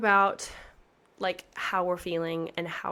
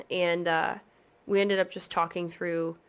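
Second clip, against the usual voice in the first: ordinary talk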